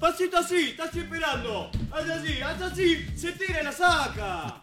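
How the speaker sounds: high-pitched, strained play-acting; excited mock goalkeeper instructions; acted keeper's coaching. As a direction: urge a teammate on in a high voice. Still acting out the keeper's moves.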